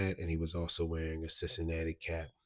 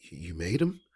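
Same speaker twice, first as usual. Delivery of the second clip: louder, rising disbelieving question; imitated surprise; 7 dB louder than usual. Gang member not expecting that response about the shoes, imitated surprise. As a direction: act taken aback, voice lifting at the end. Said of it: "he wasn't expecting that response".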